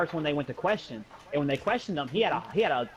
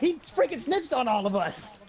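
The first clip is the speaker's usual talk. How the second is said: higher, exasperated exclamation; indignant outburst; 8 semitones higher than usual. Retold or imitated. Recalling his anger at the friend who snitched, indignant. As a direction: let the outrage burst out.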